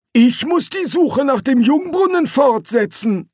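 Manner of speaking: put-on a fussy old explorer; old Quirm. A narrator's reading. (viel höhere Greisenstimme)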